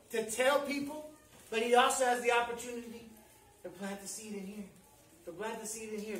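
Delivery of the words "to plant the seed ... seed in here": much softer, lower inward tone; hushed aside. Real, not acted. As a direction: drop right down, as if speaking inwardly. Inward aside after louder preaching.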